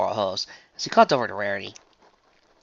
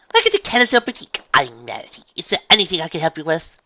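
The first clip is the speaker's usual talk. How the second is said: higher, louder unicorn greeting; Rarity, polite shopkeeper; 6 semitones higher, 8 dB louder than usual. Acted. Shop owner welcomes a visitor at the door, polite greeting. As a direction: greet a customer with ladylike poise.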